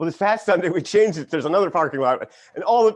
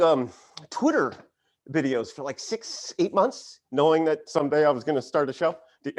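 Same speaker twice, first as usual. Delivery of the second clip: ordinary talk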